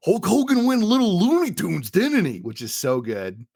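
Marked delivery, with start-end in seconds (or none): 0.1-2.4 high, strained wrestler promo voice; Macho Man. Retold or imitated. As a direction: imitate a hammy pro wrestler on the mic. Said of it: "he does say".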